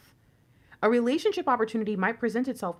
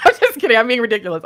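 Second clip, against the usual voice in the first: loud, giddy; 3 semitones higher, 10 dB louder, much faster than usual. Real, not acted. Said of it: "I don't know why I'm talking like this".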